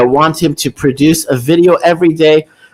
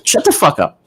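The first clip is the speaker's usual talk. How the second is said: ordinary delivery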